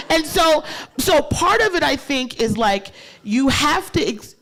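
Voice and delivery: ordinary talk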